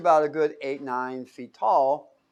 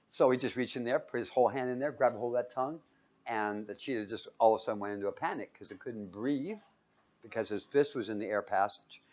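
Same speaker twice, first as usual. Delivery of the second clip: ordinary delivery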